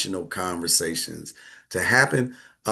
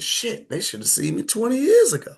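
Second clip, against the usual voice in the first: high, boastful old-man impression; imitating old daddy; 12 semitones higher than usual. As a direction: play the proud old father, pitched way up. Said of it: "He said".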